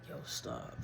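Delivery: whispered stop; hushed plea to stop. Retold or imitated. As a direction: hiss it under your breath. Hushed basement, avoiding being heard.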